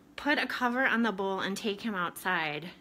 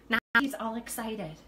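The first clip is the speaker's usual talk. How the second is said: normal talking voice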